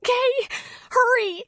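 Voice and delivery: high tearful little voice; crying. A narrator's reading. Girl agreeing to Kelly, crying. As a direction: say it through sobs. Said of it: "she said, crying".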